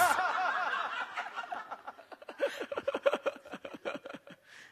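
long airy laugh trailing off; a long silent chuckle; breathy laugh. Real, not acted. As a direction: laugh it out, unvoiced. Audience joke lands.

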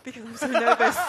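loud laughing punchline; delivering the joke through laughter. Real, not acted. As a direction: burst out laughing on the line. Punchline of the cold-blooded joke.

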